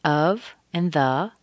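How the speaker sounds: "of" and "the" slow and full; separate full forms. Shown as a demonstration. Teacher shows words before linking, slow full "of and the". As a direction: give "of" and "the" each a full vowel. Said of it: "become of the".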